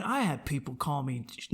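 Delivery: usual speaking voice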